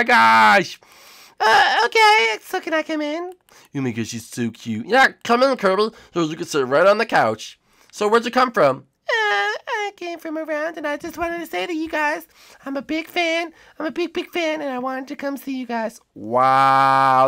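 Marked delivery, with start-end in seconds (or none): 1.4-3.3 Kirby voice. Acted. Kirby asks again. 9.1-16.0 Kirby voice. Acted. Kirby speaks to hosts.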